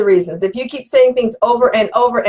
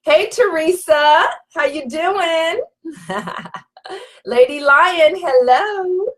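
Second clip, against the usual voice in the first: warm greeting; 6 semitones higher, slower than usual. Real, not acted. Greeting viewer warmly.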